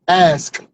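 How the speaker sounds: "Ask" over-enunciated, each consonant separate; three-syllable ask. Shown as a demonstration. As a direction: split "Ask" into three beats, the final k released. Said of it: "Three syllables".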